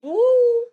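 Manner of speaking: high, loud "woo"; imitated horn toot. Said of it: "tooting a little horn".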